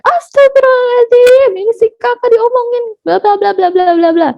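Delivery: high, exasperated rant easing down at the end; scary scolding. Acted. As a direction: act a fed-up mother launching into a tirade. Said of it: "menyeramkan gitu ya". (omelan ibu yang menyeramkan, nada tinggi)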